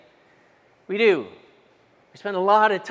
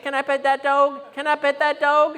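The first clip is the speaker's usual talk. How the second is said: put-on higher, lightly twanged little girl voice; a hint of an eager Appalachian child; little girl begging to pet the bear; 7 semitones higher than usual. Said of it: "the Appalachian girl".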